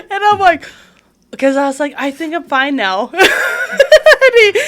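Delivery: laughing out loud through the words; big laugh bursting into the line. Real, not acted. Laughing while retelling.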